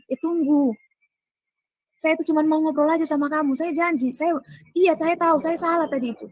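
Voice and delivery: high-pitched, insistent appeasing; retold placating excuse. Man promises he only wants to chat, placating. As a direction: re-enact someone hastily promising and apologizing, pitched high.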